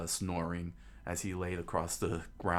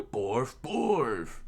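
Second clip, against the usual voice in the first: loud, higher dog barks; 6 semitones higher, 5 dB louder than usual. Acted. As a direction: bark the word like a guard dog. Dog answers the townspeople.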